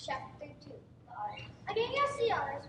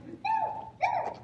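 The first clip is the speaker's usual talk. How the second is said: very high squeaky gosling protest; baby gosling voice; 5 dB louder than usual. Acted. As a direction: squeal a stubborn no. Gosling refuses.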